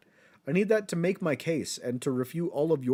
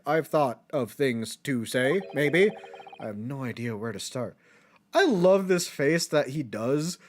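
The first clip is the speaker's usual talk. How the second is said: usual speaking voice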